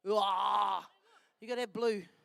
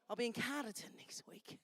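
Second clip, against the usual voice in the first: whispered aside; 3 semitones lower, 7 dB quieter than usual. Real, not acted. Aside while handing out hats.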